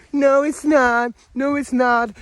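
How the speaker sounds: high, slow, sneering denial; mocking naysayers. Mocking the system's defenders, high slow sneer. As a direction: drag out a smug, dismissive "no". Mocking the complainers who deny collapse.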